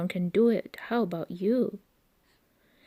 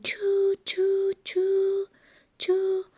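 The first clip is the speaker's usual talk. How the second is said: much higher chugging choos; train choo-choos; 11 semitones higher, much slower than usual. A narrator's reading. Reading to a child, chugging like a train. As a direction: chug like a train. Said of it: "He can go like a train".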